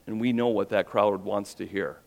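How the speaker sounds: ordinary talk, nothing marked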